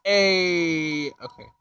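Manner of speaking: ordinary delivery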